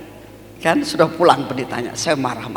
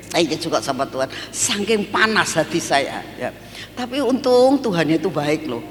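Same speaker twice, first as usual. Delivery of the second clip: ordinary talk, nothing marked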